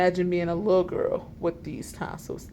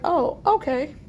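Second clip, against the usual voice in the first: mock surprise; 8 semitones higher, 5 dB louder, slower than usual.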